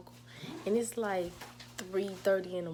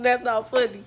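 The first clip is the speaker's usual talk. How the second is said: mocking; 4 semitones higher, 15 dB louder than usual. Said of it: "He talking about some".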